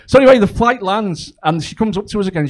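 ordinary talk

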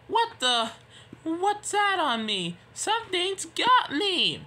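high, panicked character voice; alarmed cry. Acted. Nia cries out as something lands on her, alarmed. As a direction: cry out as if suddenly grabbed.

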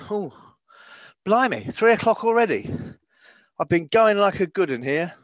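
normal talking voice

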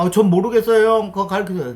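put-on a high, loud, whiny plea to an older guy; whiny junior pleading. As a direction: beg your senior like a helpless junior. Younger self begging senior for help.

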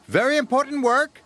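high, quick, eager burst; exclaiming. A narrator's reading. James jumps at the job, high eager exclamation. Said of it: "exclaimed James".

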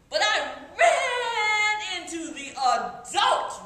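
high, loud, stretched-out excitement; excited rush. Acted. Child telling of sneaking into the adult reading room, excited. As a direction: burst out with gleeful, drawn-out words.

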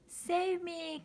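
high, pleading cry; cry for help. Acted. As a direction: beg to be rescued. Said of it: "cried the grayberry".

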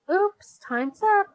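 high, loud announcement; bright-pitched storybook call across the yard. A narrator's reading. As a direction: call time to everyone, pitched well up. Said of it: "she called".

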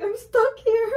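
high, laughing mock panic; mock distress. Retelling being stuck bent over, high laughing panic. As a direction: squeal it through giggles. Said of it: "I was like".

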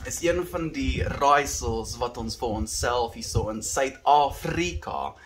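put-on Afrikaans with a South African accent; rolled R in South African accent. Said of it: "with the South African accent".

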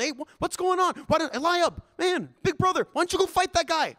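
put-on high boyish voice, eager and cheeky; little brother pestering big brother in a high voice; young David. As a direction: pitch it up like a kid egging on his big brother.

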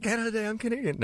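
excited. Retold or imitated. Proud Canadian bow moment.

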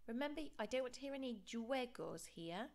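usual speaking voice

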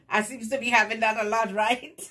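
loud, high, quick laughing delivery; joking laughter. Real, not acted. Vlogger joking to viewers about bad reputation, laughing.

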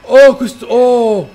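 loud, high cries of shock; startled outcry. Real, not acted. Player still reeling from the scare, startled outcry. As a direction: cry out, too startled to finish.

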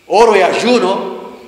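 más agudo y fuerte, lento y ufano; orgullo religioso. Retold or imitated. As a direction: presume de tu piedad ante la chica. Responde orgulloso a la chica. (responde a la chica de la agencia, orgulloso y enfático)

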